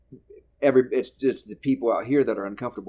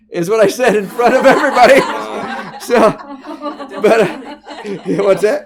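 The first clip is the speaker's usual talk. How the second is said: laughing hard through the words, much higher and louder; 12 semitones higher, 13 dB louder than usual. Real, not acted. Comic to podcast host, laughing hard at his own gaffe. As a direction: crack up as you admit it.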